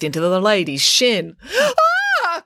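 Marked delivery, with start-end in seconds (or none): 2.2-2.4 very high theatrical shriek; pained cry. A narrator's reading. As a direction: gasp and cry out as if bitten. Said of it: "cried the woman".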